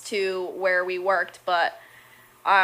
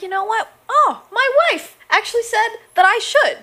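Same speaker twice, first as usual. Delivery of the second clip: imitating a friend; 12 semitones higher, 4 dB louder than usual. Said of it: "And he's like".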